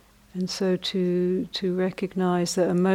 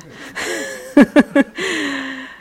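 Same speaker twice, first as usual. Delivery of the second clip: laugh; 8 semitones higher, 8 dB louder than usual. Real, not acted. Laughing at own bargaining.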